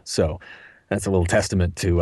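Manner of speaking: normal talking voice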